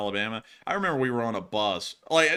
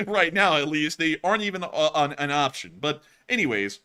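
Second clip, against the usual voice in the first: normal talking voice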